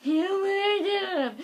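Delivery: very slow, high, loud "Oh my God"; squealing excitement. Retold or imitated. Telling everyone her news, thrilled squeal.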